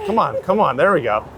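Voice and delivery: usual speaking voice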